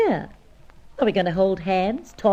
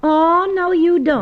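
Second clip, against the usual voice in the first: loud protest; 9 semitones higher, 7 dB louder, slower than usual. Acted. Hetty refuses to drop it.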